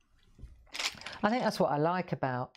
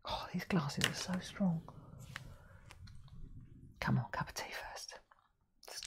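whisper; 11 dB quieter than usual. Real, not acted. Asides while settling in.

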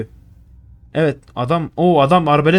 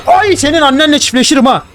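startled yell; 10 semitones higher, 4 dB louder than usual. Real, not acted. Caught by Granny, yells insult.

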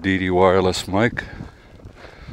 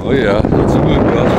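louder and higher against the gust; raised voice over wind; 5 semitones higher, 6 dB louder than usual. Real, not acted. Wind gust hits while walking.

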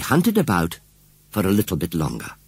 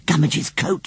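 mild excited cry; a small eager exclamation; cried out; 10 semitones higher than usual. Acted. Susan finding the coat in the hedge, mild cry. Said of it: "she cried".

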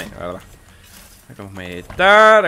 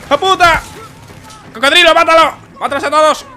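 loud high panicked yells; furious shouting; 12 semitones higher, 7 dB louder than usual. Real, not acted. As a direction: yell in angry panic under fire. Being shot, shouting at ally.

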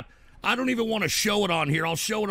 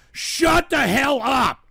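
high, loud, slow furious yell; furious shout; 7 semitones higher, 6 dB louder, slower than usual. Real, not acted. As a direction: scream it to shut him up. Furious at chat.

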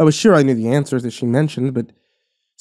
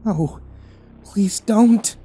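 begging; 9 semitones higher, much slower than usual. A narrator's reading. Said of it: "she begged".

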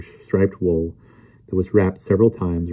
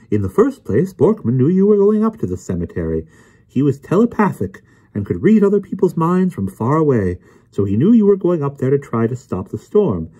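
higher-pitched, self-assured lecturer; Emerson's know-it-all voice; 6 semitones higher than usual. Acted. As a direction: explain it all with smug certainty. Voices Emerson explaining smugly.

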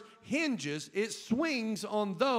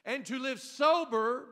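ordinary delivery

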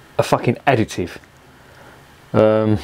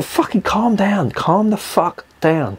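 telling himself to calm; 9 semitones higher, slower than usual. Real, not acted. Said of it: "rant over".

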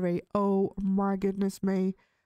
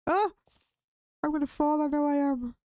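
higher, nervous about falling; fretful and a touch higher; jittery; 6 semitones higher than usual. Real, not acted. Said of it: "I'm getting the jitters".

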